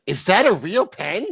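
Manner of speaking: slow, high, wide-eyed question; drawn-out mock disbelief; mock Kahuna quote. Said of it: "Kahuna just said".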